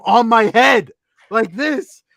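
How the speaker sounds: loud, high, emphatic retelling; worked-up exclaiming. Retold or imitated. Storyteller shows friend where the frame was, exclaiming. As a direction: build to a high, drawn-out cry of disbelief.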